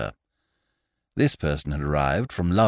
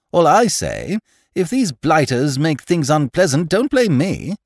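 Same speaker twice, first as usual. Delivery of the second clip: put-on brash young man; 10 semitones higher, 6 dB louder than usual. Voices young Freddy.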